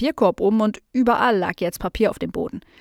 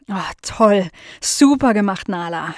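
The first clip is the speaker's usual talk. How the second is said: 1 delighted praise; 4 dB louder than usual. Acted. Aunt praises the dog.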